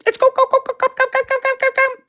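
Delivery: very high rapid go go go; excited cat calling. Real, not acted. Urging the cat to come, very high excited chirping.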